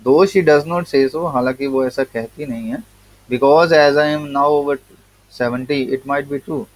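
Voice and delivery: reading the essay slowly, word by word; slow text reading. Acted. Teacher reading the English essay to students, slow and deliberate. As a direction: read each word out to the class.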